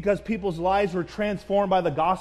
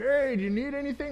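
put-on old security guard; 6 semitones higher than usual. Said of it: "this old security guard".

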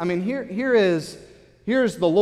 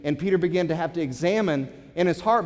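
ordinary talk

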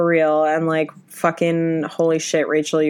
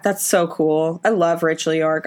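ordinary talk, nothing marked

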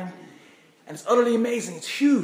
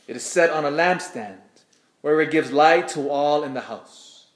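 scripture reading. Acted. Reads Gospel aloud at Mass.

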